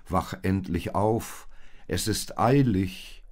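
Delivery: loud, slow, insistent; drawn-out, pressing call; urgent wake-up call. A narrator's reading. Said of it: "mahnte der Engel".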